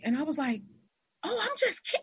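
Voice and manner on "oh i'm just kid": high-pitched retold reassurance; hasty backpedal. Teacher to weeping student, high backpedalling reassurance. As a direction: take it back quickly, pitched way up.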